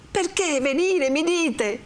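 high, pained protest; indignant echo. Acted. Renzo reproaches Lucia for asking, high and indignant. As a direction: throw the question back in high indignation.

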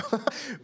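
a quick, high chuckle at his own joke; short high laugh. Real, not acted. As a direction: laugh lightly at the pun. (risita aguda y breve)

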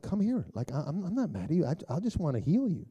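high, gentle coaxing; tender reassurance. Acted. God speaks to the wounded, acted tender reassurance. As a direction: coax someone wounded to come near.

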